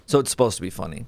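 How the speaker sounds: ordinary talk, nothing marked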